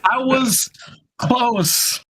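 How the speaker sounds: high, stretched-out boast; playful triumph. Real, not acted. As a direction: sing it out proudly. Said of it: "gloating after guess".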